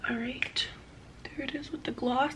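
whispering. Real, not acted. Quiet aside during makeup.

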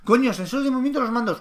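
agudo y rápido, asombro fingido; suéltalo deprisa como un envidioso; sorpresa burlona. Retold or imitated. Imitando a Sony envidiosa. (sorpresa exagerada y rápida)

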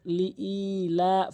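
slow model recitation of "লি ই লা"; drawn-out vowels. Shown as a demonstration. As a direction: hold "ই" and "লা" long and level. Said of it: "মদের হরকত।". ("লি ই লা" টেনে পড়া)

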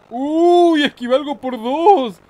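high, loud yelp of triumph over the dodge; excited yell. Real, not acted. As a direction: shout it out, thrilled to dodge twice. Dodges item again, yelling.

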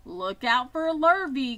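called warning. A narrator's reading. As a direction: call out a warning as a cow. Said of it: "called the cows".

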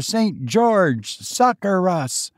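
crying out for help. Acted. Ghostly bowmen crying out.

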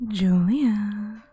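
loud breathy whisper; stage whisper the name. Pretend.

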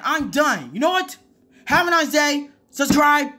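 high, whining outburst of defeat; exasperated tantrum. Real, not acted. Quits in a huff after losing.

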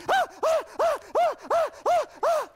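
high loud rhythmic ah-ah as he runs; breathless running yelps; panting running cries. Acted. Coyote runs off with bag.

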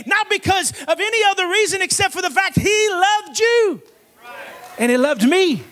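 emphatic, driving preacher delivery; full-force preaching. Real, not acted. Preacher telling the congregation he loved you, preaching at full force.

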